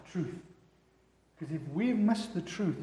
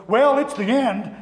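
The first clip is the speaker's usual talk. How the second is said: sidekick announcing; 4 semitones higher, 8 dB louder, slower than usual. Retold or imitated. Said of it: "his sidekick goes".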